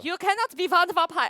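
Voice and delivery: put-on hammy Swedish vampire accent; high, loud mock Swedish accent. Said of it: "That was like a Swedish vampire accent".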